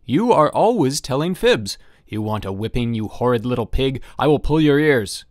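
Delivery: father losing his temper at his son, loud; angry scolding. A narrator's reading. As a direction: threaten the child, loud and cross. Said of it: "growing more and more irritated".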